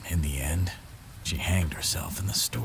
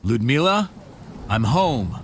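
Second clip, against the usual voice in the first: loud, raised call into the flat; 5 semitones higher, 6 dB louder than usual. Acted. Brother arriving and calling to his sister, loud. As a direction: call out to be heard through the rooms.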